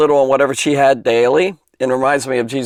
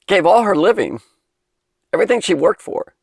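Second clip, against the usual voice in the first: getting emotional; 8 semitones higher than usual. Real, not acted. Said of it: "I'm getting emotional".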